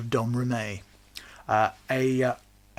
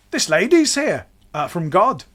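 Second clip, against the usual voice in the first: excited announcement; 7 semitones higher, 10 dB louder than usual. Retold or imitated. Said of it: "they're like".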